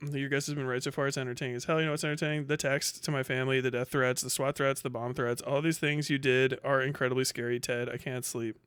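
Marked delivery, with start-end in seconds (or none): none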